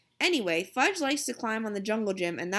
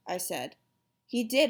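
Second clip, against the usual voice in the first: plain reading voice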